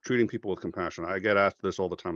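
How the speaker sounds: ordinary talk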